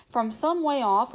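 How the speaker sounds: usual speaking voice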